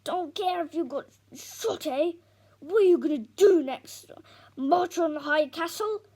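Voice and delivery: loud, mocking, slowed sarcasm; scornful shout. Dad scornfully shouts down his defiant son. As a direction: sneer it at him loudly.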